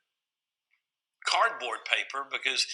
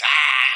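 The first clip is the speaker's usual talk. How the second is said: mock toddler yell, louder; 3 semitones higher, 12 dB louder than usual. Father imitating his daughter still screaming in timeout, mock yell. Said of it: "and she's".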